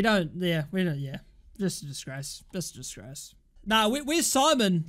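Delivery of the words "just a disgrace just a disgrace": low, soft, defeated repetition; dejected. Real, not acted. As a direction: say it to yourself, drained of energy. Dejected after conceding.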